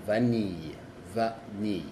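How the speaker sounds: vanille with a plain a and clear n; French vanille, no nasal; French vanille, not nasal. Shown as a demonstration. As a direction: vanille as va-ni, n sounded. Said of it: "se dice la A normal y la N".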